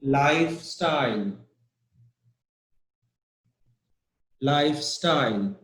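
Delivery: "lifestyle" with the beat on life, style falling; stress on LIFE. Shown as a demonstration. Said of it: "the first part".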